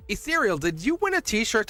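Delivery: ordinary talk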